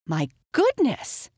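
high exclamation of surprise; startled cry. A narrator's reading. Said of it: "Pixie cried out".

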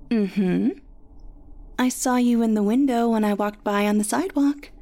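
light teasing friend voice; playful. A narrator's reading. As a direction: tease her gently, sing-song. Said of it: "Still in a playful tone".

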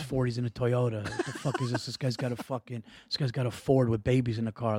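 low, soft grumble of disapproval; judgmental dad. Retold or imitated. Son imitates dad judging a Toyota driver, low disapproving grumble. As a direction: judge him under your breath. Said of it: "he would judge people".

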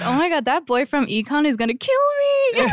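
high excited swoon; swooning fan. Retold or imitated. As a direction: gush like a smitten student. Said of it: "It's like".

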